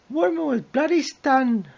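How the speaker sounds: high, hammed-up Geordie accent; mock Geordie accent. Host failing at a Geordie accent. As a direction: squeak the line in a bad Geordie accent. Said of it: "I can't do a Geordie accent".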